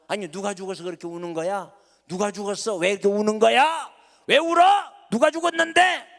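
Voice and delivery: from plain concern building to a high, loud cry; alarmed questioning. Acted. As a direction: start puzzled, end shouting why. Luther questions his crying wife.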